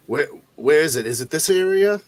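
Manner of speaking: lightly acted medic, voice lifting on the questions; in-character questioning. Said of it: "and say, uh".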